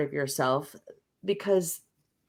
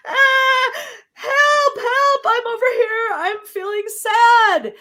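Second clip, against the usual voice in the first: high, loud, pleading cry for help; a small hurt part calling desperately to be heard; sad inner part crying out; 8 dB louder than usual. Retold or imitated. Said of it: "if that part, what, you know".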